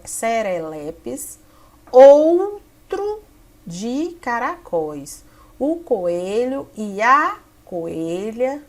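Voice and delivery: slow, halting teacher read-aloud with long pauses; drawn-out classroom reading. Shown as a demonstration. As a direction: read it slowly for young pupils, breaking between word groups. Reads sample text aloud.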